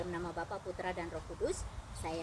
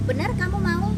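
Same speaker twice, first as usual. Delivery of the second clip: loud, high, pressing question; insistent checking; 10 semitones higher, 12 dB louder than usual. Retold or imitated. Narrator asks the woman to confirm her decision, insistent. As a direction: press hard to make sure. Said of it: "nanya tiga kali untuk meyakinkan".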